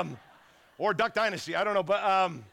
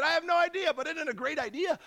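high, eager pitch, half joking; mock-thrilled shrug of a reply; excited retort; 11 semitones higher than usual. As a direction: sell the crazy idea with bright enthusiasm. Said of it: "And I said".

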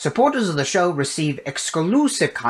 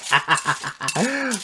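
mad scientist cackle. Acted. Mad science phase, gleeful.

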